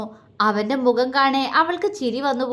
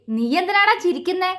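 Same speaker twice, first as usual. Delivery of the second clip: angry question pitched higher; grinding his teeth at the laughter; irritated, teeth clenched, higher; through gritted teeth; 7 semitones higher than usual. A narrator's reading. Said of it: "പല്ലിരുമ്മിക്കൊണ്ട് അവനോട് ചോദിച്ചു".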